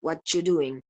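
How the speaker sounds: "What you doing?" with a ch in "whatchu"; coalescent assimilation. Shown as a demonstration. Teacher repeating the option for students, "whatchu doing" with ch. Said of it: "Puedo decir".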